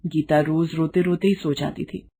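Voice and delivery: plain reading voice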